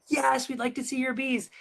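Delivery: put-on high, eager child chorus voice; kids answering. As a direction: answer together like keen little kids. Second graders answering the principal.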